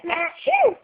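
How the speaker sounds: high-pitched pretend "achoo!"; fake sneeze. Shown as a demonstration. Mother coaxing toddler to copy her, playful fake sneeze.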